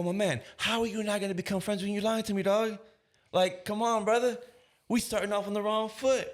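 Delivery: high, loud playful chiding; joking reproach. Retold or imitated. Officer ribbing a father who lied, joking reproach. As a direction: tease him about the lie like a buddy. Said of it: "I said it in that tone and I'm laughing with him".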